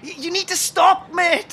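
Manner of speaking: high, urgent order; exasperated plea. Acted. Friend telling Dave to stop attacking strangers, exasperated.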